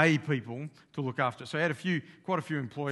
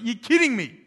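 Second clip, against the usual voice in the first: loud, high incredulous outburst; stunned, voice shooting up; acted disbelief; 9 semitones higher, 10 dB louder than usual. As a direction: play a man refusing to believe bad news. Boss reacts to losing livestock.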